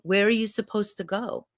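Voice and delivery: put-on gentle question to a small child; tender, caring guardian's question; kneeling protector. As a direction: ask it kindly, without the earlier bark. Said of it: "I picture her kneeling down to me".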